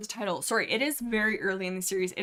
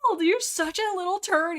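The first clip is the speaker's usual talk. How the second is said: very high squealed disbelief, then scolding; mock outrage; 12 semitones higher, 5 dB louder than usual. Reader outraged at Night Pelt, high mock-indignant squeal. Reacts to Night Pelt's retold refusal.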